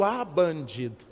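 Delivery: low, slow muttered "bandido" under the breath; muttered indignation. Retold or imitated. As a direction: grumble it to yourself, scandalised. Shocked thought at the widower. (murmúrio indignado)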